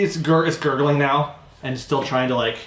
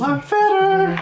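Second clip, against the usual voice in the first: high mock voice; 4 dB louder, much slower than usual. Mock voicing another player.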